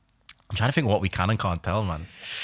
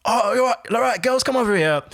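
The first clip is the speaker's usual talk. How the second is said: high-pitched mock greeting call; imitated calling over; 10 semitones higher than usual. Imitating a club host calling girls to his table, high-pitched hail. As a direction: playfully imitate a host beckoning people over.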